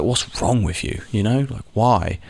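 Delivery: usual speaking voice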